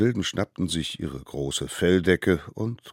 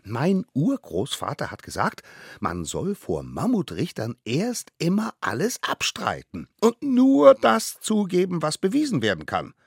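put-on high child voice, growing louder and higher; know-it-all little one; 10 semitones higher, 8 dB louder than usual. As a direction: lecture the others in a squeaky voice. Smallest one advises the others. (hohe, altkluge Kinderstimme)

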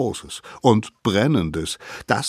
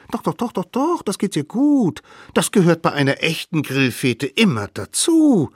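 put-on much higher, cheeky kid voice; sly children plotting a trick; high scheming kid voice; little wild kids; 10 semitones higher, 4 dB louder than usual. Kids insist.

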